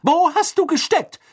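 high, loud imperious scolding; bossy, disapproving. A narrator's reading. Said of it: "sagte eine herrische, missbilligende Stimme".

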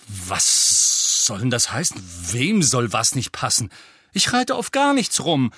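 grummelnd empört. A narrator's reading. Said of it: "rhabarberte Ron die ganze Straße entlang".

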